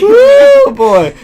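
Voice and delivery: very high, loud whooping cheer; excited whoop. Real, not acted. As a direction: whoop like at a rodeo. Cheering the crazy figure.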